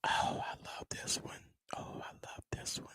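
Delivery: soft unvoiced whisper; whispering on the phone. Real, not acted. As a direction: whisper it, barely audible. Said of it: "Why am I, why am I whispering?".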